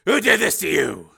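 loud, high urgent question; forceful fatherly urgency; urgent demand. A narrator's reading. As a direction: demand to know who hurt your son. Father demands answers of injured son.

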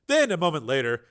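ordinary delivery, nothing marked

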